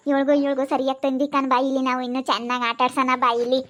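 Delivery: low, gloating ghost voice; scheming ghost. Acted. Ghost calling its friend to stir up the women, low and gloating.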